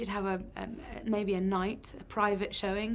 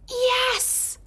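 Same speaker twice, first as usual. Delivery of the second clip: much higher, loud shouted yes; eager yes; 7 dB louder than usual. Retold or imitated. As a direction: blurt it out like an eager drunk fan. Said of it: "this woman went".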